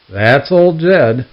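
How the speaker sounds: slow and loud, drawn out; slow drawn-out punchline. Acted. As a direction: drag out the name slowly.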